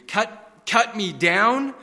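high, loud, horrified begging; horrified pleading. Acted. Bamboo begs the master not to cut him down, horrified and pleading. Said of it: "A trembling of great horror shook Bamboo".

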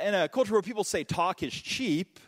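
ordinary talk

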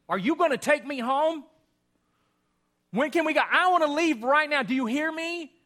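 mother pressing her silent husband to take her home; agitated mother. Retold or imitated. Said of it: "she becomes more and more agitated".

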